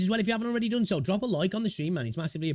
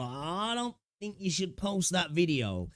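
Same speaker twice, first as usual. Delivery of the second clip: cautioning advisor; 5 semitones higher, 3 dB quieter, slower than usual. Retold or imitated. Imagined advisor warning.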